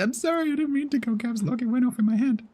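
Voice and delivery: very high, pleading put-on voice; high apology voice. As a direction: squeak out an apology in a silly voice. Mock apology after caps lock.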